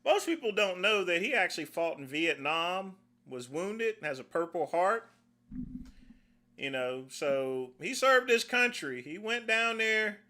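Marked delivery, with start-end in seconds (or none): none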